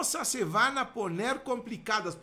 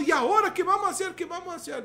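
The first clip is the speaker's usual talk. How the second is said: high, fast, loud panic; panicked crowd; 8 semitones higher, 4 dB louder, faster than usual. Acted. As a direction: cry out in alarm. Pueblo asustado, voz alta. (el pueblo de Dios gritando asustado)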